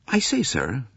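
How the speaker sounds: a hint of an obliging young American; polite young man. A narrator's reading. Young McQueen's voice.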